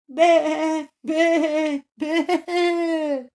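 very high, loud bleat; bleating lamb. Acted. Captive ram bleats from the bag, loud bleating. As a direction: bleat like a scared little ram.

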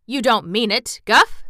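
loud, disbelieving questions; astonished cry. A narrator's reading. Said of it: "cried the king".

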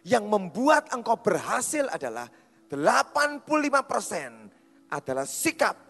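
high, loud pulpit proclamation; forceful preaching. Real, not acted. Preacher tells congregation attitude comes from within, forceful preaching. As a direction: drive the point home loudly to a congregation.

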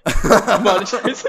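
drawn out, laughing through the word. Real, not acted. Teasing friend, laughing slowly through monetized. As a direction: stretch it slow while cracking up. Mocking demonetized joke.

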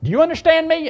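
high, loud angry demand; mad. Retold or imitated. Irate father threatening the preacher by phone, mad and shouting. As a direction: imitate a furious caller laying down a threat. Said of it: "And he was mad".

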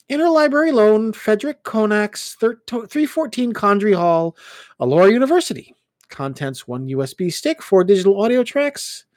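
high, bright excited reading; chirpy girl reading a label; excited young woman. Acted. Ellen reads label aloud.

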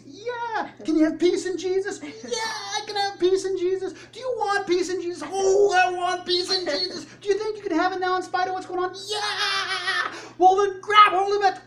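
very high, loud call and answer; cheering crowd hype. Acted. Preacher acting a crowd cheering for peace, hammed-up frenzy. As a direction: escalate into screaming pep-rally frenzy. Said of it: "We hear the cheering".

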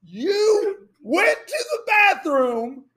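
wife yelling in exasperation; imitating a yell. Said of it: "She goes".

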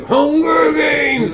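high, long-held mock shout; mock dramatic shout. Podcaster needling the rip-off series, hammed-up shout. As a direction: hold the title high, hammed-up and drawn out.

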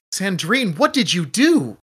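high-pitched, appalled question; acted dismay, voice rising high; shocked disbelief. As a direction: ask in genuine-sounding shock, pitch climbing. Deck confronts the elven woman, shocked.

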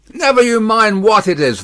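high, rebuffing shout; guard's suspicious comeback; loud retort. A narrator's reading. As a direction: shut the stranger down. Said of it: "the guard retorted".

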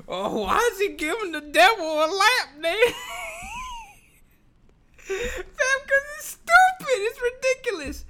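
high, laughing, teary words; cracking up mid-rant. Real, not acted. Vlogger mocking the critics, laughing.

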